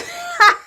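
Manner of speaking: high, helpless burst of laughter; laughing fit. Real, not acted. Pausing the video as a funny memory hits, helpless laughing fit. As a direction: crack up until it sounds like crying. Breaks down laughing before her story.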